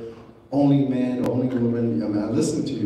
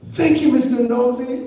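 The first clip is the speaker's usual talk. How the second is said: mocking son-in-law; 11 semitones higher, 6 dB louder than usual. Son-in-law's remark, retold.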